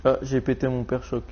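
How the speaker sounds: ordinary talk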